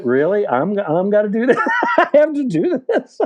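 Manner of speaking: high, incredulous questions through laughter; can't believe he has to do this; laughing in disbelief at the EMT's instruction. Retold or imitated.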